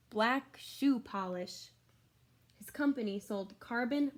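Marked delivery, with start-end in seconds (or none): 0.1-1.7 slowed down. Acted. Slowed end of list.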